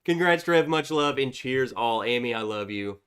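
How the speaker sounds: ordinary talk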